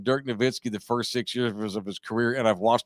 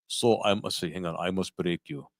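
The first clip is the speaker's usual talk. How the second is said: low, quiet Russian movie villain; tentative low Russian voice; attempted Russian accent; 6 semitones lower, 5 dB quieter than usual. Shown as a demonstration. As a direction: try out a menacing Russian accent. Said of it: "I'm trying to think about the Russians".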